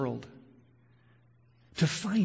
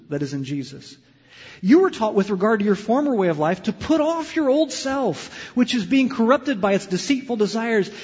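passionate preaching; 6 dB louder than usual. Acted. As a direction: drive each verse home.

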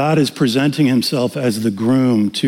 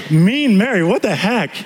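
mock disbelief; 6 semitones higher than usual. Incredulous at nickname.

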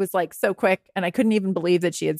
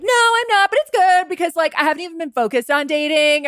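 high, over-bright excuse-making; overcompensating; 8 semitones higher than usual. Acted. Single person explaining the way out, overcompensating. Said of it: "overcompensate and be like".

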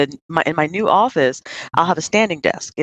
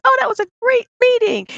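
high mock cheer; 3 dB louder than usual. Mocking colleagues after the hike.

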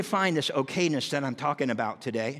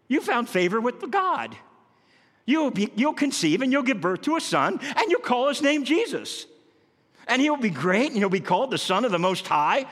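joyful announcement; 8 semitones higher, 4 dB louder than usual. Pretend.

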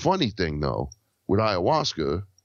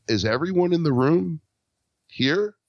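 amazed high question; 8 semitones higher than usual. Retold or imitated. Retelling his trip, asking friends in astonishment. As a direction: pitch jumps up high in wonder.

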